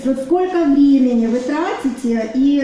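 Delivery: ordinary talk, nothing marked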